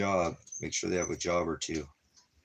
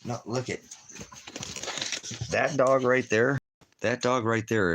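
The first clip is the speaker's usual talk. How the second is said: excited pointing; 6 semitones higher, 4 dB louder than usual. Real, not acted.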